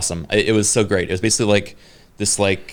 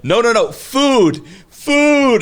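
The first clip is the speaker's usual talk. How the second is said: high, slow, loud pleading no, no, no, food; mock pleading; 13 semitones higher, 5 dB louder, much slower than usual. The host asks the guards for food, loud and desperate. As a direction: stretch out food, desperate, like a tourist with no words.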